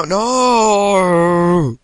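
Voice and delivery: high, long drawn-out "no"; dismayed wail. Real, not acted. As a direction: wail as the crash interrupts you. Cut off by a crash.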